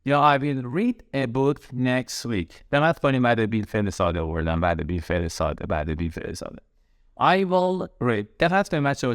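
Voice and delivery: usual speaking voice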